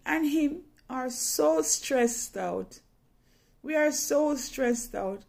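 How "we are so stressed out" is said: ordinary delivery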